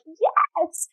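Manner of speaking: ordinary talk, nothing marked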